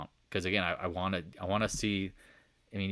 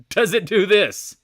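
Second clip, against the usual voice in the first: loud, high and drawn out; laughing exclamation; 12 semitones higher, 12 dB louder, slower than usual. Shown as a demonstration. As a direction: blurt the question out through laughter. Repeats it loudly, laughing.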